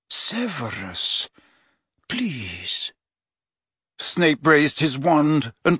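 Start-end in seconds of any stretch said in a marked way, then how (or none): 0.1-2.9 much softer, slow desperate plea; hushed pleading. A narrator's reading. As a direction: beg him quietly. Dumbledore was pleading.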